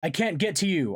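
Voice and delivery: high, urgent call; yelled. A narrator's reading. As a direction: call out helplessly to trapped friends. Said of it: "I yelled".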